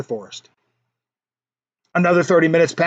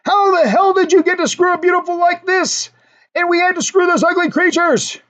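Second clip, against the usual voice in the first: high, indignant shout; furious yell; 12 semitones higher than usual. A narrator's reading. Two friends furious at the third guy, jealous high yell. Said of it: "the two yell at him".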